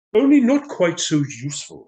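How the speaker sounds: lightly voiced fussy official; councillor adds. Councillor correcting himself before the crowd, mildly pompous. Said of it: "he added".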